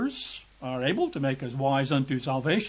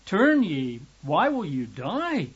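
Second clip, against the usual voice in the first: urgent, higher-pitched appeal; pleading; 4 semitones higher than usual. Acted. God begging his people to turn back, pleading. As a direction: plead with them, pitch lifting on each appeal. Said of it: "pleading to his people".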